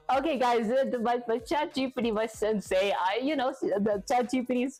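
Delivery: high-pitched, stammering protest; flustered self-defense. Real, not acted. Streamer defending the ChatGPT to chat, flustered. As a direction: trip over words, pleading innocence.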